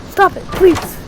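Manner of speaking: usual speaking voice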